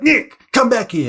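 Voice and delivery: put-on high, loud call after a runaway child; mum yelling out. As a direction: yell from the back door as an angry mum. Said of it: "she yells out".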